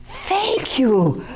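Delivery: high, bright-eyed delight at being yelled at; delighted thanks. Retold or imitated. Therapist delightedly thanking an angry client, high and thrilled. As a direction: thank them like it's a gift.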